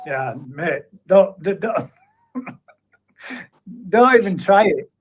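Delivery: normal talking voice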